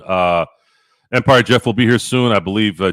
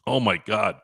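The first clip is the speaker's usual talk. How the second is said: ordinary talk, nothing marked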